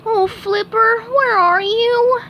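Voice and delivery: very high, slow mournful call; squeaky woeful plea; lament. A narrator's reading. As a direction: pine for the spatula. SpongeBob laments.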